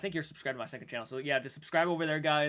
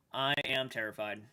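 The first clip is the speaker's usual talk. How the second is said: ordinary talk, nothing marked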